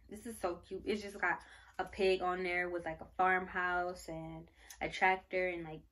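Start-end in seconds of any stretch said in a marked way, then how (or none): none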